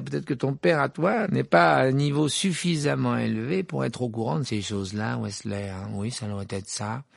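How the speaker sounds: high, sneering mock voice; Malfoy jeering at Weasley's father; taunting Malfoy. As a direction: rub it in with a smug laugh.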